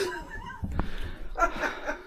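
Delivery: breathless high laugh. Real, not acted. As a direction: burst into wheezing laughter. Laughs at melodramatic wife.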